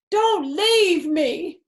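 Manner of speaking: high, loud, very slow wailing plea; hammed-up plea. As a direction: beg dramatically as if dying. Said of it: "cried Fox".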